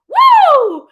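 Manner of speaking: loud high cry of release; real overwhelmed whoop; emotional woo. Real, not acted. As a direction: let it burst out. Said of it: "getting emotional".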